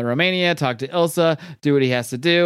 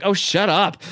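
retold exasperation, pitched up; higher, lightly acted irritation; exasperated retort; 6 semitones higher, slower than usual. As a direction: cut off the speechmaker, mock fed-up. Retelling Hellboy interrupting a speech.

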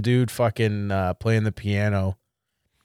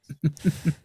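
weak breathy chuckle; barely laughing; half-hearted laugh; 5 semitones higher, 5 dB quieter than usual. Real, not acted. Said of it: "most half-hearted ass laugh".

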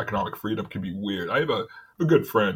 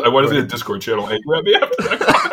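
normal talking voice

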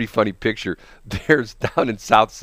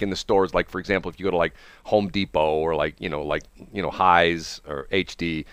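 normal talking voice

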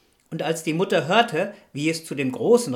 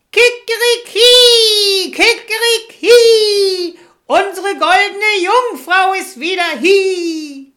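very high, loud, drawn-out rooster crow; 14 dB louder, much slower than usual. Acted. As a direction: crow it out slowly at full volume, far above normal pitch. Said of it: "saß der Hahn auf dem Brunnen und rief". (krähender Hahn, laut und gedehnt)

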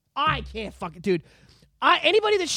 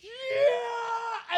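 high, drawn-out, muted "yeah"; slow-motion yell imitation; 11 semitones higher, 6 dB quieter than usual. Shown as a demonstration. Imitating a slow-motion fight scene for Mark, drawn-out mock yell. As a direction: stretch out a held-back battle cry in mock slow motion. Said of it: "I'm gonna imitate it for Mark".

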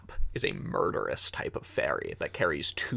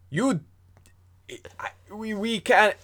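put-on high-pitched broken child's voice; scared stammer; 12 semitones higher, 5 dB louder than usual. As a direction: Fifth stammers that they can't. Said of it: "He says".

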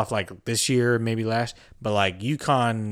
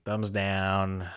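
normal talking voice

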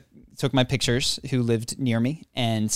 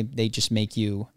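usual speaking voice

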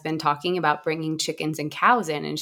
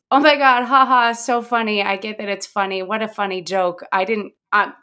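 mock amused gushing; 3 semitones higher, 5 dB louder than usual. Woman calling back about a funny joke, mock amused gushing. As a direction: imitate a caller gushing over a joke, spoken ha-has. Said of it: "and she's not laughing".

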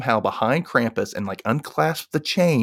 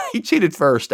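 laughing through the correction, higher; a laugh spills into a higher voice; 8 semitones higher, 4 dB louder than usual. Real, not acted. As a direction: chuckle as you fix the line. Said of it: "laughing correction of own line".

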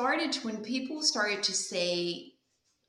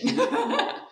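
high loud laugh; 5 dB louder than usual. Real, not acted. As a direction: burst out laughing. Laughs after punchline.

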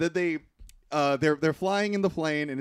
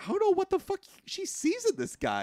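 high, baffled outburst; can't believe her taste in men; incredulous disbelief. Retold or imitated. Viewer baffled by the heroine's choice, high and incredulous. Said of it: "I'm like".

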